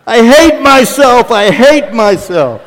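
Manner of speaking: loud, slow, high anguished cry; mock self-loathing shout. Pastor after embarrassing a visitor, hammed-up self-loathing. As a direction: wail it out in comic mortification.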